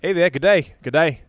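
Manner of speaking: perky Aussie greeting; put-on Australian twang; attempted Australian accent. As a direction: try a greeting in mock Australian. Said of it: "the Australian accent".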